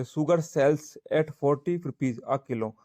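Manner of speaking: dictating word by word to students; teacher reading an example aloud, very slowly; slow dictation. Shown as a demonstration. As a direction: read the sentence out very slowly. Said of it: "reads example sentence".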